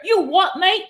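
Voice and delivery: mock British accent, "You what, mate?" rising at the end; imitating a British lad squaring up, British accent. Angry British guy in a Hong Kong bar, mock British accent. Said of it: "Or maybe I said that wrong".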